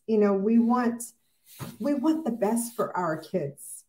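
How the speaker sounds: usual speaking voice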